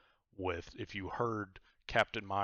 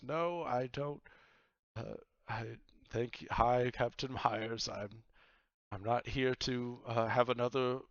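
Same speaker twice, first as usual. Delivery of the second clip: lightly voiced, a bit higher woman's voice; Vaku's voice; 4 semitones higher, 4 dB louder than usual. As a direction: politely turn down the pirate. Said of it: "and she says".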